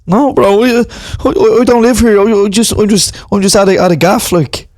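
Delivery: put-on lairy young lad in tracksuit; lad at a gaff. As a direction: call out to the boys, cocky. Same lad figure.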